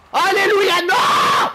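very high, loud outburst; real gamer yell; shouted dismay. Real, not acted. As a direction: scream it as the chance slips away. Chance lost in live match.

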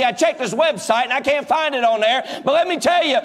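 ordinary delivery

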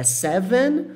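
"seven" with a clear final N; seven correto. Shown as a demonstration. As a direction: rise onto "seven", closing on N. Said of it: "e o seu N". ("seven" com N no fim)

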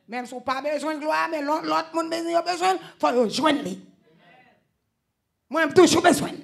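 swelling sermon climax; preaching at full force. Acted. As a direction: build the call to a peak.

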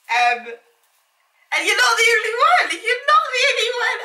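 high, hammed-up mock sob; whiny fake-crying wail. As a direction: pretend to weep over the dress. Mock tearful over bad dress.

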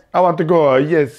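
lightly imitating an Italian speaking English; Italian-accented English. Host mimics how an Italian speaks English, in a mock Italian accent. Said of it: "İtalyanca tonlamasıyla İngilizce konuşuyor ya".

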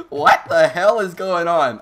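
slow, loud exasperated question; loud disbelief. Real, not acted. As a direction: drag it out in disbelief. Disbelief at teammates.

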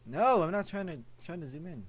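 loud, high outburst; frustrated protest. Real, not acted. Gamer fighting the zoom control, loud high protest. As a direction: cry out against the controls.

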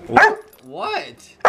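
high squeak of surprise; sudden squeak way up high; squeaky. Real, not acted.

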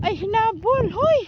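high, loud squeal of surprise; high-pitched excited holler; excited exclamation. Real, not acted. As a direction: cry out high at what you just spotted. Exclaiming at birds giving chase.

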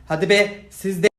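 loud contemptuous get-out; angry dismissal. Real, not acted. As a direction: wave them off, loud. Dismissive end of angry rant.

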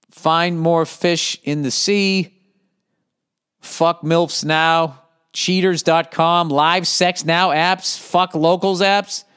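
spelling out each app name slowly; drawn-out deadpan list. Real, not acted. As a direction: read them out deliberately, one by one. Reading app names slowly.

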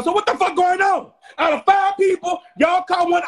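high, loud angry shout; confronting police. Retold or imitated. Confronting the police about the thieves, shouting angrily. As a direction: shout your demand at the cops. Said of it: "So I go walk over there".

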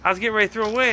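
ordinary talk, nothing marked

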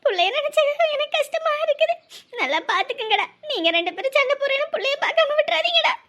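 high, tearful pleading; crying. Acted. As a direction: worry for the child through tears. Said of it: "நீங்க அழாம இருங்க".

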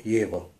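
«ева» starting on «йе», no h; altered pronunciation. Shown as a demonstration. Teacher shows the changed word, Russian «ева». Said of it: "превращается в слово".

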